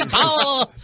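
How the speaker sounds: high, loud preacher call; preachy guy. Retold or imitated. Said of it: "he always does the preachy guy".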